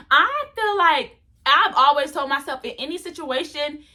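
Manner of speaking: ordinary talk, nothing marked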